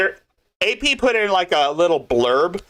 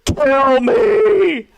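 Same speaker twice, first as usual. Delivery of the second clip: high, loud, hammed-up crying wail; mock crying; 12 semitones higher, 6 dB louder than usual. Streamer imitating her favourite crying clip, loud mock wail. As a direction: imitate a desperate sobbing scream. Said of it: "where she's crying".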